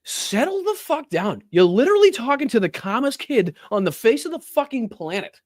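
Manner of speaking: high-pitched, incredulous telling-off; exasperated scolding. Real, not acted. Streamer telling a debater to settle down, exasperated. Berating the man in clip.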